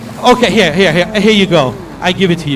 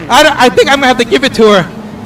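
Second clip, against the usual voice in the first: ordinary delivery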